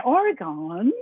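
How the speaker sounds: Oregon ending in a full GON; variant pronunciation. Shown as a demonstration. Asking the interviewer how Oregon is said, variant pronunciation. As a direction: say Oregon with a drawn-out "gawn" ending. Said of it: "Do you say Oregon or Oregon?".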